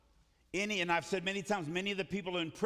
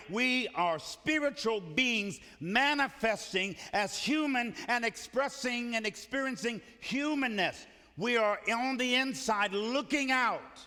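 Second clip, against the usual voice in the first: preaching at full force; 4 semitones higher than usual. Acted.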